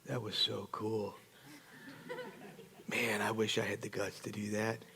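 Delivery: hushed, low confession, nearly whispered; real quiet. Retold or imitated. Guy who yelled most, to Johnny, secretly admiring hush. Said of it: "walks up to me real quietly".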